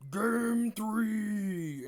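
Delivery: high, loud, drawn-out announcer call; hype announcer shout. Acted. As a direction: announce it like a big-event hype man. Hyped announcement after rocket line.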